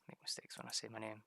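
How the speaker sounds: whispered aside to himself; sheepish whispered confession. Real, not acted. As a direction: drop to a whisper on the admission. Repeats line, then whispers it.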